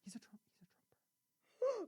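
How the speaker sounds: unvoiced gossipy whisper. Real, not acted. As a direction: whisper it like a secret. Gossip reveal.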